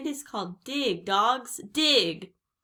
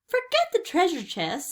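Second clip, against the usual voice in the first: high, raised voice; urgent insistence; 10 semitones higher, 5 dB louder than usual. A narrator's reading. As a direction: cut off the excitement and urge leaving. Urging Jack to flee the storm.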